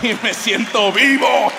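slow, loud, high thrill. Real, not acted. Comedian confessing the rush of stealing hotel pens, thrilled. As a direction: savor it like a rush.

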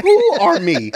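loud, high, mock-preachy cry; exultant church shout; exclaimed preacher punchline. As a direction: belt it like the Sunday climax.

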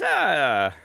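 normal talking voice

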